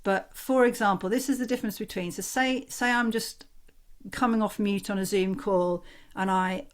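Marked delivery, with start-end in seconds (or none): none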